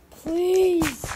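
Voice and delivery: frustrated plea. Real, not acted. Gamer begging to use a diamond, exasperated shout. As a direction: burst out loudly, begging the game. Frustrated with game not responding.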